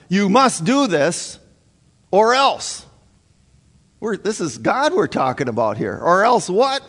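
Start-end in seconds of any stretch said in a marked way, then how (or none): 0.1-2.8 "or else" with "else" raised high and dropped in a threat; hint of a gunman's ultimatum, "else" falling hard; mock threat. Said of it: "holding a gun to God's head telling him". 6.0-6.9 "Or else what?" with "else" raised high and rising to "what"; defiant challenge. Retold or imitated. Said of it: "would be a good question".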